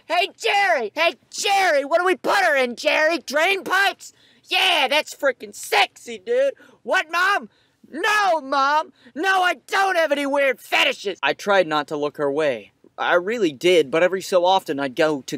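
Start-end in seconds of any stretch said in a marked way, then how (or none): 0.1-11.2 high-pitched shouting dude. Acted. Loud high caricature shouting.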